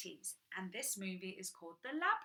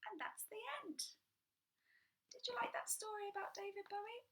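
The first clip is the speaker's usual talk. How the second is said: whisper; 12 semitones higher, 6 dB quieter, faster than usual. Real, not acted. Ending story time for children.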